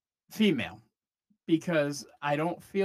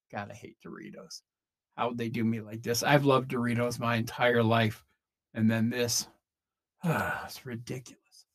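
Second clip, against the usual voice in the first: mock despair; 8 semitones lower, 4 dB quieter than usual. Betrayed by a Doritos chip.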